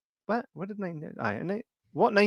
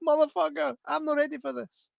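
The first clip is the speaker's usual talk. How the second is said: high panicked voice. Retold or imitated. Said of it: "I'm like".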